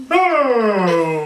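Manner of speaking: a high, loud, wide-open "Oh!"; the frog reacting to Missus Cow, wide-mouthed "Oh!". A narrator's reading. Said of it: "that's the way they talked".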